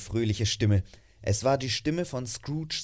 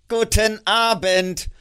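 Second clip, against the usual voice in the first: louder, higher, slow and testy; irritated goodbye; 9 semitones higher, 9 dB louder, slower than usual. Acted. Scrooge repeats his goodbye again, loud and testy. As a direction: drag each syllable out in annoyance.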